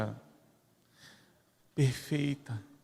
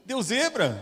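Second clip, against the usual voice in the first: mock surprise; 11 semitones higher, much slower than usual.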